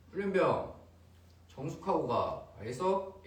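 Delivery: loud, high drill sergeant command; barking drill instructor. Retold or imitated. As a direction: shout at trainees like a drill instructor. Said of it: "약간 이런 느낌".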